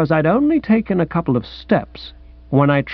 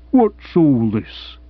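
lightly voiced policeman; slower than usual. Policeman looms in the fog.

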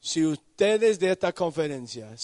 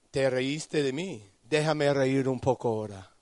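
Dios que se burla bajito; 5 semitones lower, 6 dB quieter than usual. Acted. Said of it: "Y él dice".

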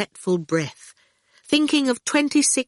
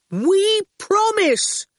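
high, slow, drawn-out call; 13 semitones higher, 4 dB louder, much slower than usual. A narrator's reading. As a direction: stretch the words to carry. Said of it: "Howard called".

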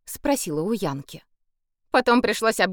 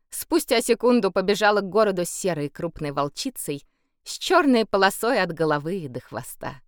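plain reading voice

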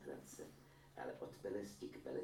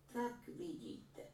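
put-on higher, slow voice of a proud old mother; a granny boasting about her son's painting; proud old woman; much slower than usual. A narrator's reading. (vyšší pomalý hlas pyšné stařenky)

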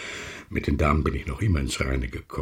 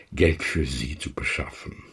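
ordinary talk, nothing marked

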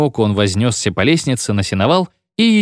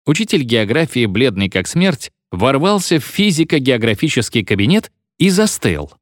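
plain reading voice

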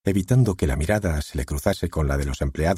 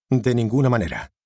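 firm, higher and louder repeated no; insistent refusal; 5 semitones higher, 3 dB louder than usual. A narrator's reading. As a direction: refuse again, stubbornly. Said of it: "repitió Steven". (repitiendo la negativa con firmeza)

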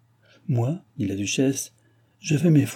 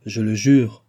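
solemn, louder oath; majestic; 5 dB louder, slower than usual. A narrator's reading. Duke swears amid the group of women, majestically. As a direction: pronounce it like a ceremonial vow. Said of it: "et dit majestueusement".

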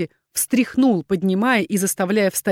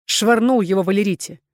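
usual speaking voice

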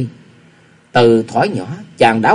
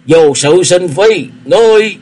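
slow, higher, louder furious outburst at the name; shouting; 6 semitones higher, 5 dB louder, slower than usual. A narrator's reading. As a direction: shout his name then choke off. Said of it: "quát hỏi".

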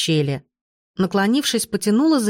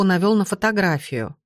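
ordinary delivery

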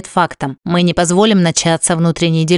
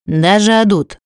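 plain reading voice

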